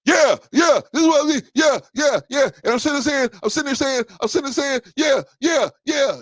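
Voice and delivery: high, loud, relentless hollering; Rico at ten. Retold or imitated. Host imitates his friend shouting on the phone, high loud yelling. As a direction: yell over the listener at full blast. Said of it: "like, right at ten".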